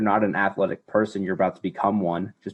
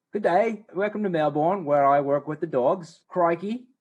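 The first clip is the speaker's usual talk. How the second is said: higher, hammed-up Australian dog trainer; mock Australian accent; 6 semitones higher than usual. Host mocks Australian trainer videos his wife watches, higher hammed-up Australian accent. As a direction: play the cheesy Aussie trainer, higher up. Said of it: "seven Australian, uh, videos".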